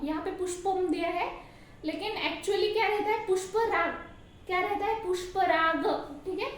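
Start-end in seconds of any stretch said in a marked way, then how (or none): none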